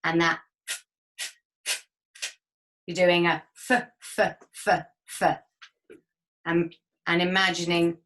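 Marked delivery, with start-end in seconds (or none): 3.7-5.4 short sharp F puffs; staccato. Shown as a demonstration. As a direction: blow four quick candle puffs. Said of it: "four staccato Fs".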